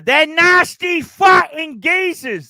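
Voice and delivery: loud, high, slow Cockney geezer shout; booming mock Cockney hard man; Cockney accent. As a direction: bellow it like an angry London geezer. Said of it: "are we gonna get the Cockney accent already".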